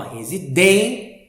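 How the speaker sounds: "residência" broken up with DÊN stretched firmly; stress on accented syllable. Shown as a demonstration. Teacher recapping the circumflex, stressed accented syllable. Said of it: "com um pouco mais de força".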